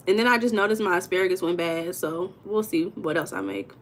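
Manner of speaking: ordinary talk, nothing marked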